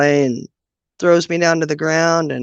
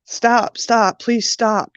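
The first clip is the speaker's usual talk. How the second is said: higher, slow pleading of a small boy, lightly voiced; retold child's pleading; 6 semitones higher, much slower than usual. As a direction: plead slowly like a scared four-year-old. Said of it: "Ronnie was just begging her".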